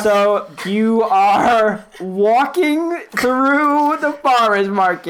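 very slow, drawn-out words; suspenseful stretching. Acted. Game master walking the player toward the reveal, suspenseful. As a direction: stretch every word to tease the reveal.

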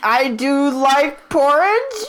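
high, slow, hesitant voice; hammed-up timid agreement; unsure captive. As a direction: play along slowly and uncertainly. Intimidated man plays along, unsure.

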